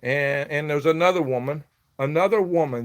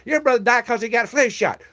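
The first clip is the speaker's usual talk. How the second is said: high, dismissive mimic of the man; mocking the stranger; 10 semitones higher, 3 dB louder than usual. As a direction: imitate his know-it-all tone, pitched up. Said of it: "He said".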